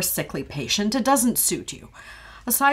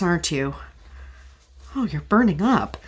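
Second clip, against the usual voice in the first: usual speaking voice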